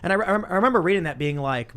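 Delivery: ordinary delivery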